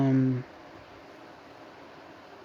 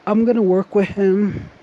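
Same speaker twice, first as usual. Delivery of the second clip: strained, mid-stretch, pushed out louder; stretching groan; 5 semitones higher, 4 dB louder than usual. Real, not acted. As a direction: say it while stretching hard. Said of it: "Sorry, I'm stretching".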